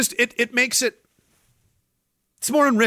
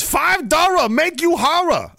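put-on high, loud, slow Vietnamese-accented solicitation; mock Vietnamese accent; 14 semitones higher, 5 dB louder, slower than usual. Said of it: "No, it's a Vietnamese hooker".

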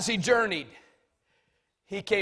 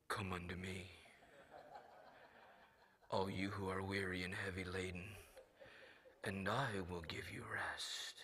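mock holy voice, much softer, breathy and hushed; hallowed tone; 13 semitones lower, 19 dB quieter than usual. Mocking how movies make Jesus speak, hushed hallowed voice. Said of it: "As though Jesus talked in hallowed tone".

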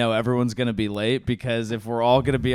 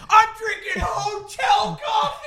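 high outraged shout; slower than usual. Retold or imitated. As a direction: shriek it like the coffee insulted you. Mark's outraged retold cry.

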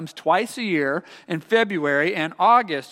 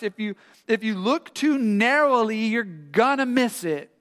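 higher, urgent coaching voice; acted pleading parental lecture; insistent dad explaining; 5 semitones higher than usual. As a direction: reason with an upset child, insistently. Dad instructs crying son in story.

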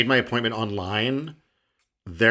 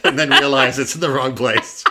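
laughing; 3 semitones higher, 6 dB louder than usual. Real, not acted. Laughing about the nurse.

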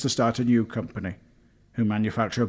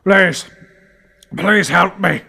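high, loud begging; desperate gasp; 10 semitones higher, 10 dB louder, slower than usual. A narrator's reading. Paul cowering wounded before Barry, begging desperately. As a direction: beg loudly, wounded and gasping. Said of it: "he managed to gasp through the blood".

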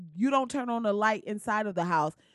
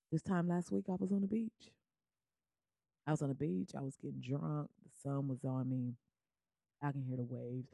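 wistful reminiscing; 7 semitones lower, 9 dB quieter than usual. Real, not acted. Dreamy beach reminiscence.

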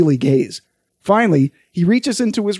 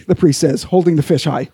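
usual speaking voice

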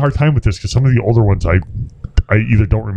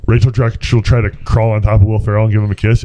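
ordinary talk

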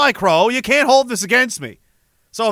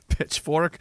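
ordinary talk, nothing marked

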